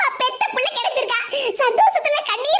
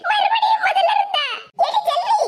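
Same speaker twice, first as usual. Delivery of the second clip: exasperated shout; 7 semitones higher than usual. Acted. Exasperated outburst at repeated hug.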